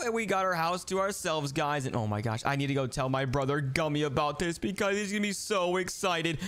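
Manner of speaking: normal talking voice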